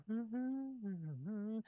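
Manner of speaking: soft, high wordless hum; humming while busy at work; absent-minded humming. Acted. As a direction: hum a little tune to yourself. Character hums while writing out tune.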